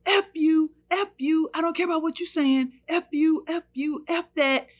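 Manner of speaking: high, loud repeated cursing; cursing shout. Retold or imitated. Husband cursing at wife, high loud shout. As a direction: hurl each curse at her. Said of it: "he starts saying".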